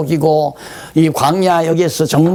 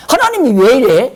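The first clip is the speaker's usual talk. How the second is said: higher, louder grumbling at God; annoyed complaint; 8 semitones higher, 4 dB louder than usual. Retold or imitated. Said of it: "열받는 거야". (짜증 난 불평)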